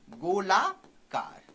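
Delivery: "गोलाकार" stretched out, rising on "गोला", then dropping; slow dictation. Shown as a demonstration. Teacher dictates a term to students, "गोलाकार" slowly stretched. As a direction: draw "गोलाकार" out in two slow parts.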